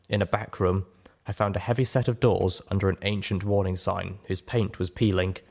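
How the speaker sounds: ordinary delivery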